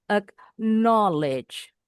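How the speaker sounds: "Acknowledge" very slow, with "know" lifted; stress on second syllable. Shown as a demonstration. Teacher demonstrates the apostrophe mark, "Acknowledge" stressed on the second syllable. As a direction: stretch and raise the middle of "Acknowledge". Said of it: "no-nya intonasinya agak sedikit lebih tinggi".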